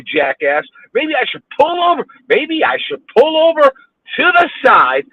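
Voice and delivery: high, exasperated mock offer; sarcastic anger. Real, not acted. Driver offering to pull over for a honker, sarcastic anger. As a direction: throw out a heated sarcastic offer.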